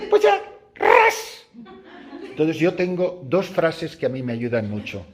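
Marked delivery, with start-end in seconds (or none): 0.1-1.5 high, slow rip noise, laughing; ripping sound. Retold or imitated. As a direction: make the plaster tearing sound.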